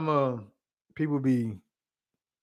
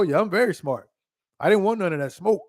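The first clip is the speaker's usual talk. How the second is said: normal talking voice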